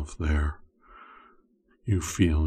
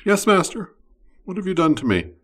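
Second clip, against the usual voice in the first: much higher, louder voice of the summoned slave; submissive reply; 14 semitones higher, 7 dB louder, faster than usual. Acted. Slave answering her master in a high startled voice. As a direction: squeak it out, startled and obedient. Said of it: "listener's reply".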